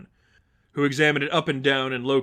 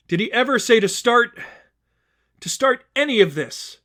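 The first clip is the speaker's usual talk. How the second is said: higher, louder questioning rebuke; Stephanie, challenging; 8 semitones higher, 7 dB louder than usual. Acted. Stephanie challenging the worshippers, pointed rebuke. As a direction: make them doubt their faith.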